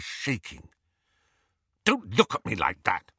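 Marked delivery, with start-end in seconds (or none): 1.8-3.1 irritable, testy retort; snapping. A narrator's reading. Harry still shaking with anger at Hermione, snapping. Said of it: "he snapped at Hermione".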